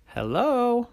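high, tentative hello; timid monsters' greeting. A narrator's reading. Monsters who got their courage up, timid greeting.

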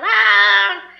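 high, loud exasperated "ah"; frustrated cry. Retold or imitated. Acting out her spiral of doubt, a loud frustrated cry. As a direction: throw out a burst of exasperation. Venting chaos of self-doubt.

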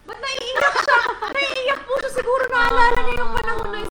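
high, loud startled worry; noticing mama tearing up; surprised concern. Real, not acted. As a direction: react in alarm.

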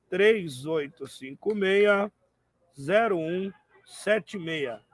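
slow, careful digits; slow number read. Shown as a demonstration. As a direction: spell the number out slowly so they can write it down. Repeats phone number slowly. (número dito devagar)

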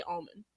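almond said "ah-mun"; almond without D. Shown as a demonstration. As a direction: drop the d in almond. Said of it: "I don't think I say the D".

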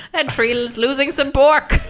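loud, high, slow Boston "bork"; hammed-up Boston, "bark" as "bork"; mock Boston accent. Host mocks co-host's tree bark, exaggerated Boston accent. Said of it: "how they would say it in Boston".

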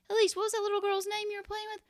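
very high, quick parent question; chirpy parent. Acted. Parent quizzing child in the car, chirpy. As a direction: ask brightly in a high, hurried parent voice.

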